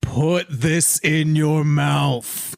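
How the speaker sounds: drawn out very slowly; slow deliberate. Acted. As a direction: say each word slowly and firmly. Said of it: "to her face and be like".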